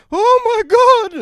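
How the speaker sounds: very high, loud shriek of surprise; startled old lady. Retold or imitated. Elderly lady startled when he stands up into her.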